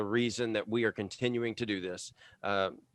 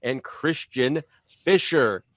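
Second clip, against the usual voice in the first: podcast host intro; 5 dB louder than usual. Acted.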